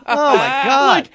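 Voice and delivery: high laugh then slow oh my God. Real, not acted. Laughing at clown joke.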